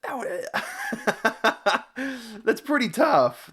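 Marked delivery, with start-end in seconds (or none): 0.5-2.4 a bursting, high, incredulous laugh; real laugh. Real, not acted. Reacting to the Hindi census video, high laugh. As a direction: laugh out in disbelief.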